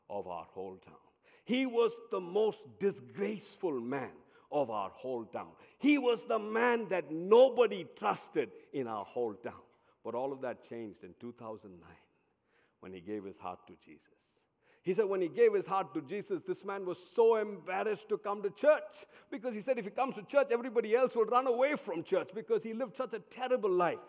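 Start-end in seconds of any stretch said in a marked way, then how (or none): 1.4-9.5 loud, emphatic sermon delivery; full-force preaching. Acted. 14.8-24.0 loud, high, urgent sermon storytelling; raised, driving preacher's delivery; full-force preaching. Acted.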